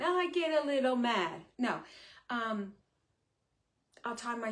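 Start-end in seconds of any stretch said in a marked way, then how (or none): 0.0-1.4 loud, higher hammed-up Italian accent on "I get a little mad"; mock Italian accent. Shown as a demonstration. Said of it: "Now I sound like I'm Italian".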